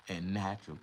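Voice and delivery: ordinary talk, nothing marked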